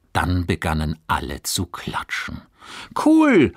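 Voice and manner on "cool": high, loud cry of delight; excited call. A narrator's reading. Classmate calling out, thrilled after the reading. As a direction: shout it out, thrilled. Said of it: "rief eine Stimme".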